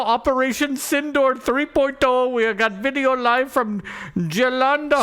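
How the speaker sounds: high, hammed-up breaking-news voice; mock news anchor. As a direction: announce it like a TV anchor. Reading a news headline aloud.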